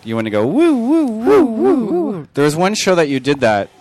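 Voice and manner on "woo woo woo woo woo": high mocking woo woo; mocking hoots. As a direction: hoot it high like a siren. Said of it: "make fun of that sound".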